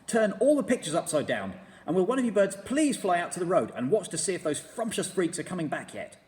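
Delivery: high, loud, eager commanding creature; a bossy little beast giving orders; Mugglewump voice. A narrator's reading. Mugglewump directing the birds, loud and high.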